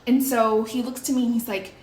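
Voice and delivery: ordinary talk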